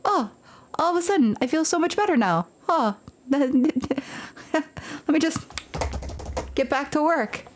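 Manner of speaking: suddenly cured and cheerful; miraculous recovery. Retold or imitated. To the boss, suddenly healed, mock cheerful. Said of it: "I'm like".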